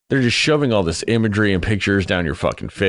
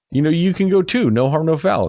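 breezy reassurance; 10 semitones higher than usual. Retold or imitated. Villagers breezily reassuring the girlfriend.

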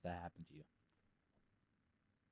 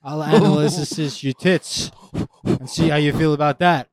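mock threat; 5 semitones higher, 10 dB louder than usual.

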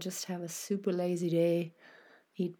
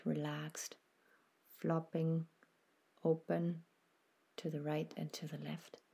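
guided meditation; 4 dB quieter than usual. Acted. Host guiding listeners through a body scan, soft meditation voice. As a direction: ease the listener into stillness, unhurried and gentle.

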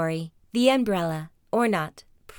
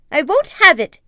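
higher, louder brave protest; defiant cry; 8 semitones higher, 9 dB louder than usual. Acted. Sniglet defying the creature, loud brave shout. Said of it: "he added".

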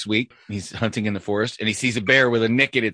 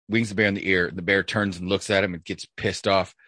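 ordinary talk, nothing marked

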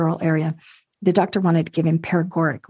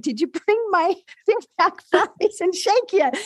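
teasing husband; 11 semitones higher than usual. Retold or imitated. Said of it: "And he said".

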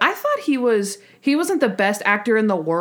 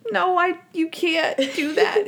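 flustered Monk; 9 semitones higher than usual. Retold or imitated. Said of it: "And Monk is like".

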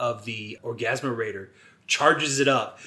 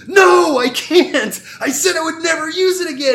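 high, loud mock wail; hammed-up anguished refusal; 9 dB louder than usual. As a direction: protest desperately, overacted. Acts Ben refusing mid-fight.

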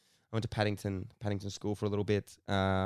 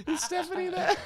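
put-on much higher girly voice breaking into laughs; fake girl voice laughing. Asking the dad for his daughter, much higher laughing girl voice. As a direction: fake a girl's voice while trying not to laugh. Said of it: "I was like".

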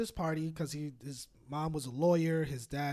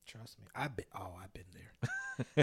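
whispering; 7 semitones lower, 3 dB quieter than usual. Real, not acted. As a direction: breathe it out softly. Whispered, slow aside.

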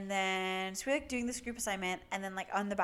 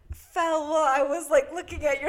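louder, higher playful flirt; 6 semitones higher, 10 dB louder than usual. Retold or imitated. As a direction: toss off a cheesy pickup line. Retold friend's flirty comment.

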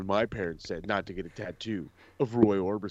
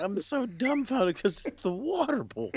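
high incredulous; 12 semitones higher, slower than usual. Real, not acted. Disbelief about Chad.

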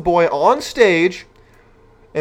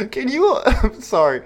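laughing; 9 semitones higher than usual. Real, not acted. Laughs reading shocking news.

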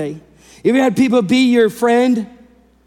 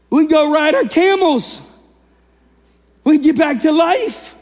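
high, jubilant acted-out anticipation; rallying pretend excitement, pitched well up; excited lepers; 6 semitones higher than usual. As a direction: play men thrilled to be going home. Voicing lepers imagining going home.

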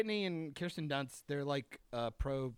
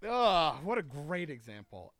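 ordinary delivery

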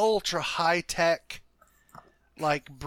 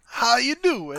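high, loud smooth Travolta-ish greeting; Travolta-style heartthrob; 7 semitones higher, 5 dB louder, slower than usual. Acted. Player doing his handsome NPC's greeting as a greaser. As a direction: lay on the greaser charm. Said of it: "I can't do the voice like I want to".

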